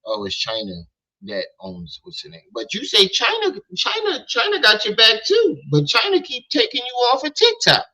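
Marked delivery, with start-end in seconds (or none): 2.5-7.9 spottend hoch und übertrieben; mocking. Mocking S0's praise of China.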